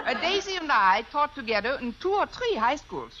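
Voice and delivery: play-acted German accent; put-on German accent. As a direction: pretend German woman on the phone. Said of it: "If I can do a decent German accent".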